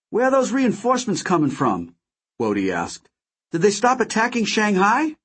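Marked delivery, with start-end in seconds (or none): 0.1-1.9 put-on young pilot voice. A narrator's reading. Character voice in read story. 3.5-5.2 put-on young pilot voice. A narrator's reading. Character voice in read story.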